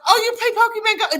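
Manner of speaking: a waiter lighting up at the game; imitating a surprised waiter. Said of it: "he goes".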